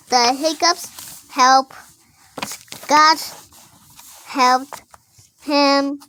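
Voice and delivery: loud, slow word-by-word reading. Real, not acted. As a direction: sound out each word loudly. Child reading aloud, loud and slow.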